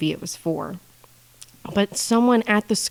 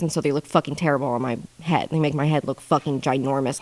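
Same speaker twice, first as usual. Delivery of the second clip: ordinary delivery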